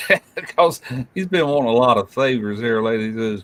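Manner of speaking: usual speaking voice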